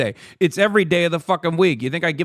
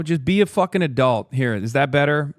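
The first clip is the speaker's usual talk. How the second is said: ordinary delivery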